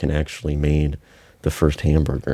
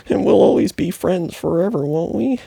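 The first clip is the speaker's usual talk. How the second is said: high, sweet cartoon-child voice; acted child voice; 12 semitones higher than usual. Friends acting out Bambi, high cartoon voice. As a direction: play the little fawn, sweet and high.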